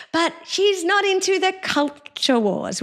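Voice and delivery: high, lilting mockery of her phrase; mocking sing-song. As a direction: parrot her sweet tone with scorn. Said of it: "this really annoying sing-song voice".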